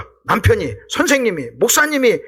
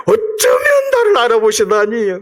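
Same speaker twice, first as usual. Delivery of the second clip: slow, choked-up mock weeping, louder; mock tearful gratitude; 4 semitones higher, 4 dB louder, slower than usual. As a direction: pretend to be moved to tears, slowly. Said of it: "베드로는 할렐루야". (감격해 우는 흉내)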